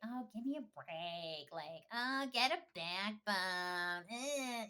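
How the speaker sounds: loud, drawn-out mocking of a mother; exasperated scoff, leaning on the long words; scornful mock scolding. Fake mother brushing off a crying girl, loud mock scorn.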